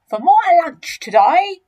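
big greedy crocodile announcing a feast; enormous crocodile, gloating. Acted.

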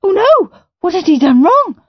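put-on boy panicking in front of the Queen; panicked. A narrator's reading. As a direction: panic as you realise the mistake. Peter panicking at his mistake.

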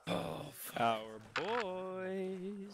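very slow, stretched high mock; drawn-out mock echo. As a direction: stretch the words out, teasing. Teammate echoes the order mockingly.

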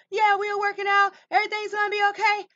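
high, fast quoted voice; imitated reassurance. Voices somebody reassuring the listener.

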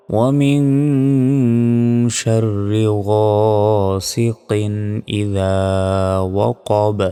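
very slow, sustained tajweed recitation; measured melodic model recitation; Quran recitation. Shown as a demonstration. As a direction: hold the nasals and long vowels.

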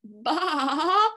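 wavering, drawn-out bleat; sheep bleat. Shown as a demonstration. Reader shows children the sheep sound, bleating. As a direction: bleat the word itself like a sheep.

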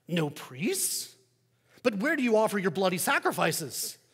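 Roman neighbor. Acted. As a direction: play the curious pagan neighbor, bright and pushy. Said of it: "the neighbor says".

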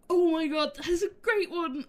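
high, loud squeal of sudden discovery; excited panic. Retold or imitated. Streamer acting out spotting a Great One, excited shriek. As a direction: yelp it out like you've just spotted a Great One. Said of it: "screeching 'cause I'm panicking".

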